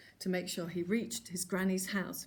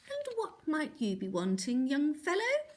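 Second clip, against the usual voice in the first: put-on menacing old lady; 6 semitones higher than usual. A narrator's reading. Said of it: "a voice that made legs jelly".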